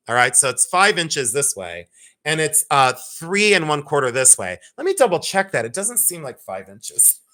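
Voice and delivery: normal talking voice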